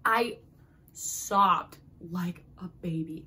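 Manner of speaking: very slow, each word spaced out; drawn-out confession. Real, not acted. Book fan confessing to viewers how hard she cried, slow and deliberate. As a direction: stretch it out word by word.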